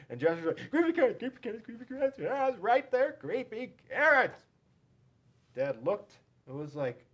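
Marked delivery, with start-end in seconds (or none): 0.7-4.4 high, fast panicked repeating; boy frantically pointing for dad; frantic kid. A narrator's reading. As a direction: insist in a panic. Jasper frantically points them out.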